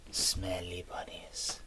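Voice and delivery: breathy whisper of the words "Smelly bunnies". Acted. Repeating the phrase, whispered.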